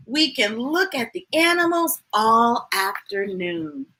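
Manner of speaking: lively sing-song picture-book reading; reading to a child. A narrator's reading. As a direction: read it bright and bouncy for a little one. Reading a rhyming picture book aloud.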